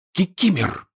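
high-pitched cry of surprise; startled exclamation. A narrator's reading. Harry sees the house-elf suddenly appear, startled exclamation. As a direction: call the name out, pitch leaping. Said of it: "а Гарри воскликнул".

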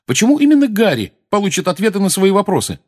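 high, loud angry demand; angry question. A narrator's reading. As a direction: challenge them angrily. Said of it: "сердито спросил Фред". (сердито спросить)

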